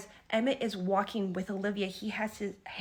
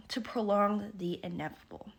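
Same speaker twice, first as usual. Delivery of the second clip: usual speaking voice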